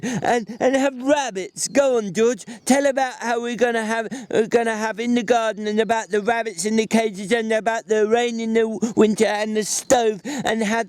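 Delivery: Lenny finishing the familiar lines, overjoyed; Lenny, delighted. A narrator's reading. Lenny begging for the story.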